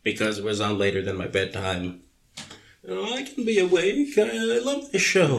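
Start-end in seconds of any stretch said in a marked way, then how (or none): 2.9-5.4 high put-on child voice; mock little kid begging to stay up; much higher, wheedling child impression; pleading kid. Voicing self as pleading child.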